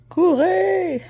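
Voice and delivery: sudden playful yell to flee; mock shout. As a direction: shout it like a warning. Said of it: "C'est une joke".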